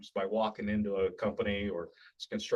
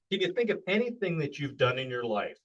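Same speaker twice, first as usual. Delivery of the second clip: usual speaking voice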